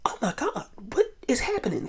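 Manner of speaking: high, hushed disbelieving question; stunned shock. Retold or imitated. Retelling her reaction to the neck bite, high and hushed. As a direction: gasp it out in disbelief. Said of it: "and I was like".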